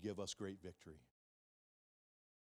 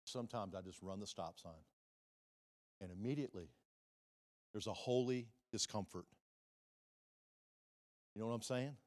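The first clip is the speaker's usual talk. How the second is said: ordinary talk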